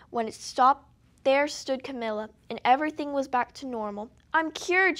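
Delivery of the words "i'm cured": higher and louder, bursting with joy; joyful shout. A narrator's reading. Cured girl shouting with joy in her room. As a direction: shout it out in triumph. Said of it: "she shouted".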